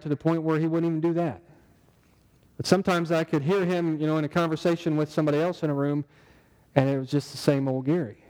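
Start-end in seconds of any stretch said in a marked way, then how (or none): none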